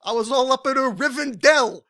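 high, loud cartoonish character voice; Paul F. Tompkins character. Comic riffing to co-host, lightly put-on high character voice. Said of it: "turned into that Paul F. Tompkins character".

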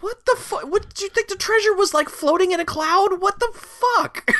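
high squeaky disbelief, slowing at the end; mock exasperated squeal. Ranting at the movie characters in a high mock squeal. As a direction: shriek it like you can't believe it.

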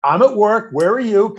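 high, sarcastic retort. Retold or imitated. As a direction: throw the question straight back at the caller. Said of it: "I was sarcastic".

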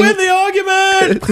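high-pitched frustrated cry; arguing with myself. Acted. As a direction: wail it in defeat. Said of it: "me arguing with my girlfriend".

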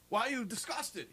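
high, questioning voice; puzzled sexton. Retold or imitated. Sexton asking the visitor why, mock puzzled. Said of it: "said to him".

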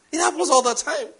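dismissive. Retold or imitated. Retelling his reaction to bad weather.

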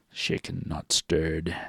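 low, slow-delivered suave spy line; lightly played secret agent catchphrase; James Bond. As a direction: drop it down low and hushed. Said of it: "You could see James Bond".

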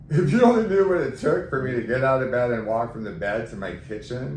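loud, exasperated retort, rising to a high come on. Retold or imitated. Retelling her fed-up reply to friends who complained, loud and exasperated. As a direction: let them have it, fed up.

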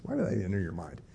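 ordinary talk

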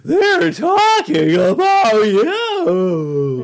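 very high, cracking, hugely drawn-out cry; hammed-up squeaky crack, stretched very slowly; exaggerated voice crack. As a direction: stretch every word high until it cracks. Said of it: "hopefully their voice cracks like that".

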